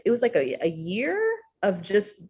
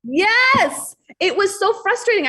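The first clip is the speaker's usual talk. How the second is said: high, loud, exasperated yes; high emphatic agreement; 5 dB louder, slower than usual. Real, not acted. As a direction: burst out in frustrated agreement.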